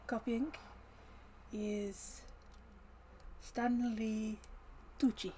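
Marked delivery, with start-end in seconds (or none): none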